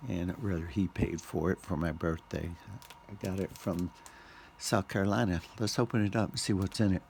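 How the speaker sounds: usual speaking voice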